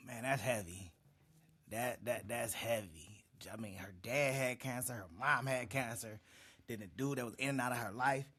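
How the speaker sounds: soft, low, sighing disbelief; hushed and heavy. Real, not acted. Reactor quietly absorbing the guest's loss, hushed and heavy-hearted. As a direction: let the sad news sink in quietly.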